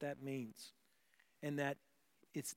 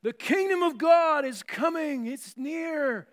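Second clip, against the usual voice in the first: much higher, much louder booming proclamation; hammed-up herald; 10 semitones higher, 13 dB louder, slower than usual. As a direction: boom it out like a street-corner herald. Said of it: "They're like".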